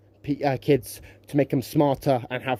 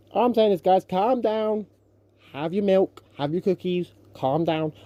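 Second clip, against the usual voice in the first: mock soothing; 6 semitones higher, slower than usual. Soothing angry commenters, mocking.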